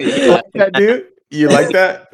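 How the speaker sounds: high teasing laugh; frat bro. Real, not acted. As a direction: tease him, laughing.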